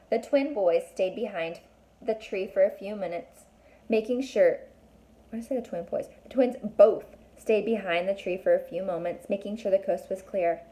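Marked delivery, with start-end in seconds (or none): none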